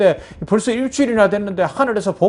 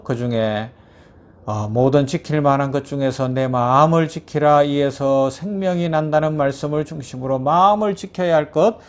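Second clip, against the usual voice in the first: closing prayer; 4 semitones lower than usual. Real, not acted. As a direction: pray earnestly to God.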